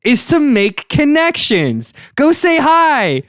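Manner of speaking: rising, excited sales pitch; hype pitch. Acted. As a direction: sell it bigger and bigger. Pitch sells creepy app, rising hype.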